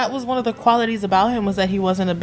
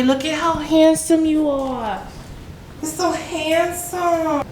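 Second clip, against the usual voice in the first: higher, slow cooing praise; pet talk; 6 semitones higher, slower than usual. Real, not acted. Owner cooing at new puppy.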